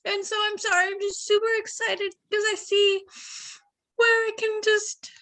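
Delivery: fighting back tears of excitement; emotional, near tears. Real, not acted. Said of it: "I'm getting emotional 'cause I'm super excited".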